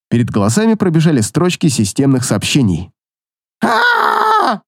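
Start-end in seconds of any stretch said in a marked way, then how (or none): 3.6-4.6 high piercing scream; drunk boy's scream. A narrator's reading. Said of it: "заорал обладатель".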